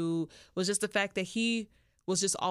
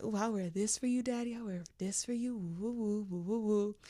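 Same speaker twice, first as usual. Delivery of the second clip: flirty tease; 2 semitones higher, 4 dB quieter than usual. Acted. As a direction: play it coy and seductive, cooing. Voicing herself teasing her man.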